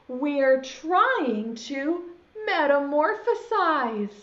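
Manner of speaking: slow, stretched-out words; pointed explanation. Acted. Friend hushes caterpillar while trying to change, slow and pointed. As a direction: draw out every word of the rebuke.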